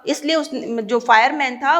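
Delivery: normal talking voice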